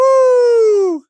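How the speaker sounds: high whoop; hammed-up woo; mock cheer.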